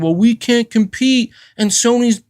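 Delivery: mock aggrieved corporate griping, voice pushed up; mock Microsoft complaint. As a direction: mimic a big company pleading that it's being bullied. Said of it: "Microsoft coming out here and saying".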